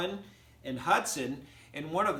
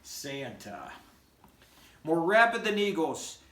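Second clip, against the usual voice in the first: lively, singsong storybook reading; animated read-aloud for young listeners; reading to children; 2 semitones higher, 4 dB louder than usual. Acted. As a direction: read it like a bedtime story for kids. Teacher reads poem to children.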